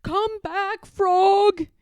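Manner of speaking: high, loud calling shout. Acted. Toad calls for the vanished Frog, high loud shout. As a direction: call after him desperately. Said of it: "he shouted".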